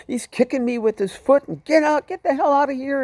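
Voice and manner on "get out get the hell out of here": high-pitched angry yell; acting out a raging driver; enraged driver. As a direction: shout it high, driving someone away. Angry driver throwing passenger out.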